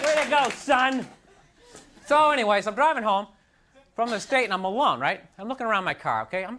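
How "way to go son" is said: slow, dry congratulation; a dad glad the date ended early; mock approving dad. Her dad at the door.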